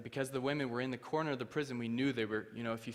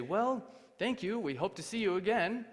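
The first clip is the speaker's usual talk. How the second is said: polite official farewell; 9 semitones higher, 5 dB louder than usual. Retold or imitated. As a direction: imitate officials seeing off a guest.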